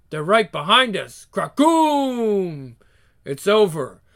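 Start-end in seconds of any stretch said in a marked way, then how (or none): 1.3-2.7 explosion sound. Acted. Voiced explosion.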